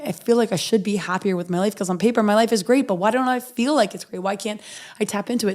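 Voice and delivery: ordinary talk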